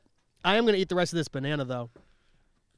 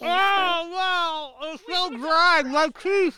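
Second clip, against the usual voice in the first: high, strained and loud, mouth full of dry peel; struggling to swallow; 11 semitones higher, 6 dB louder, much slower than usual. Real, not acted. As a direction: force it out while gagging on dry food. Choking down dry banana peel.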